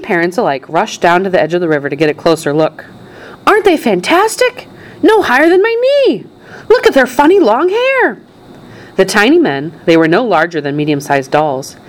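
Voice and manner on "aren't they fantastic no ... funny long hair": high, amazed onlookers' cries; excited exclaiming. A narrator's reading. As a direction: marvel aloud in squealing excitement. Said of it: "everybody started shouting at once".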